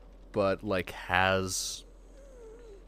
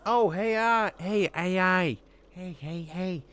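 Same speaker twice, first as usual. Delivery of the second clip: high, sing-song calling for attention; mock AI user; 9 semitones higher, 3 dB louder than usual. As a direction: call the AI like a bored user. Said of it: "but just like".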